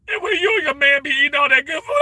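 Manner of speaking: rising into a high, loud mock shriek; mock food-envy scream. Streamer joking about a viewer's food videos, high mock shriek. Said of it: "I be like".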